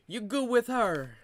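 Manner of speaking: broad mock Italian-American accent, playing a wiseguy. Comedian riffing on family life in an Italian accent. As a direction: lay on a thick Italian accent like a mob cousin. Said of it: "We talk all choppy like".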